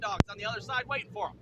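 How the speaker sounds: normal talking voice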